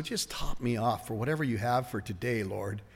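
soft, low-key aside to God; quiet prayer. Acted. Believer asking the Lord for today's filling, quiet prayer. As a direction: say it softly, as a private prayer. Short morning prayer acted out.

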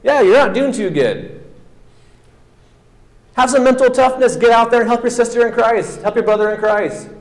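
preacher urging the congregation to help anyway; tough-love exhortation. Real, not acted. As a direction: push the church to get up and help.

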